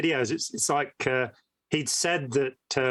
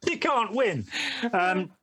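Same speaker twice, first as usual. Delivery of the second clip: ordinary talk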